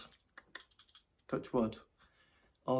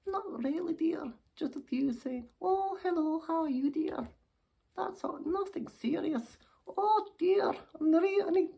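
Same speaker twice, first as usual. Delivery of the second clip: put-on very high-pitched, twittery old dear; flustered old lady; 4 dB louder than usual. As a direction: play a coy old lady getting flustered. Old lady recounts other offers.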